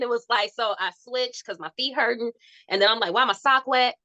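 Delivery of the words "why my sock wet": usual speaking voice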